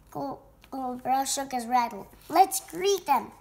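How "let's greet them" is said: high, loud and drawn out slowly; rally friends with excitement; eager call. A narrator's reading. Cobra sees guests coming, eager.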